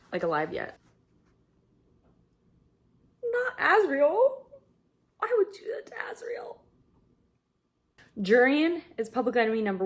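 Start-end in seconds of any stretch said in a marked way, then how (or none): 3.2-4.4 higher and slow; dismayed plea. Real, not acted. Reader fearing for a character, pleading. 5.2-6.6 high, fast, crying whisper; whispered sob. Real, not acted. Reader upset at the cruelty, crying whisper. Character hurt.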